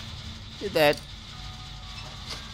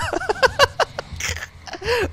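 high-pitched laugh. Real, not acted. Laughs after the swear.

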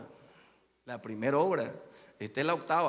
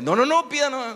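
insistent host; 11 semitones higher, 10 dB louder than usual. Retold or imitated. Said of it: "le dije yo".

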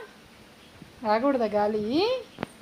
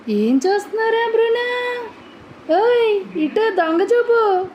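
much higher, cooing sing-song to the dog; 11 semitones higher, 4 dB louder, slower than usual. Real, not acted. Mother cooing at pet dog.

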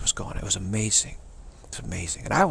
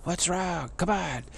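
mock yelling; 7 semitones higher, slower than usual. Audience heckling the singer on stage, mock yelling. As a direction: imitate a crowd calling out, pitched well up. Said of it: "people started standing up and yelling".

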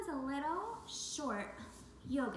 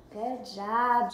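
ordinary talk

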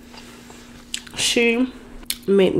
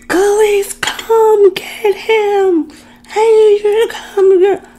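high, loud, drawn-out mock scream; imitated screaming; 13 semitones higher, 6 dB louder, slower than usual. Storyteller imitating her friend screaming for help on the phone, high mock scream. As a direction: imitate a frantic scream down the phone, stretching the words. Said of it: "all I hear is her screaming".

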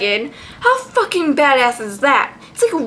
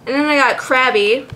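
usual speaking voice

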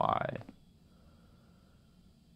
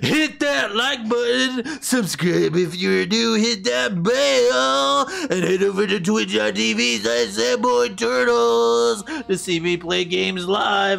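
silly outro voice; 9 semitones higher than usual. Pretend. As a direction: ham up the outro sign-off. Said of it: "I don't know why I'm talking like this".

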